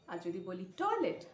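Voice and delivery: normal talking voice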